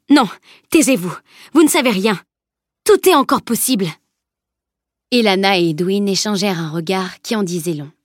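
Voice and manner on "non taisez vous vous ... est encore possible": urgent, rising protest; insistent denial, climbing higher as it goes; vehement interruption. A narrator's reading. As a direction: cut them off, refusing to hear it. Girl cuts off feared bad news.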